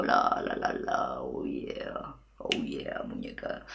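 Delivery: creaky low singsong, very soft; vocal fry. Shown as a demonstration. Student humming in fry while washing dishes. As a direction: croon playfully in fry. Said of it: "Intentad hablar con este sonido".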